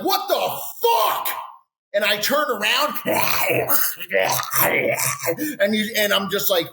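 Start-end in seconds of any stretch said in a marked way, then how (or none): 0.0-1.3 high shrieking what the fuck; outraged shout. Retold or imitated. Getting drilled by a tiny lineman, shrieking high in shock. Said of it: "I'm like".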